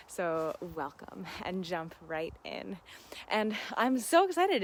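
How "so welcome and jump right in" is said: whispering. Real, not acted. Soft aside to viewers.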